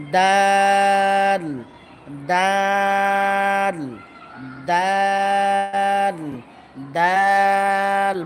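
slow chanted letter name দাল; long drawn-out dal. Shown as a demonstration. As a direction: stretch দাল into one long held note. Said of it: "বলো, বলো".

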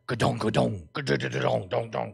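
low mouth-made thudding and tumbling; vocalised bonging rattle; imitated chimney clatter. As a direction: voice the thumps of a creature in a chimney. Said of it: "because we would hear".

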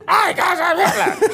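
very high, loud squeal; comically shrieked range demo; high end of range. Shown as a demonstration. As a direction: shout it far up in pitch. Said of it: "this is my range as an actor".

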